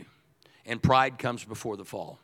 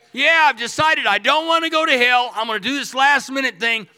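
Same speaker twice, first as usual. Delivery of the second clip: sarcastic mock quote; 12 semitones higher, 9 dB louder than usual. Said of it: "He's brilliant".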